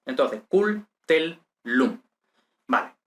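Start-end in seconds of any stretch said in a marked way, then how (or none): 0.5-2.0 "cul, tel, lum" as three detached syllables. Shown as a demonstration. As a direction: split the double l between "tel" and "lum". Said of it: "la parte implosiva de la sílaba anterior".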